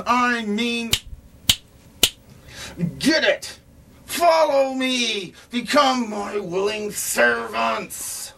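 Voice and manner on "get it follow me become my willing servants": commanding. Acted. McCain ordering kids around.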